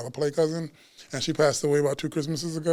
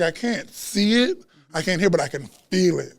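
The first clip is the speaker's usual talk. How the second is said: ordinary delivery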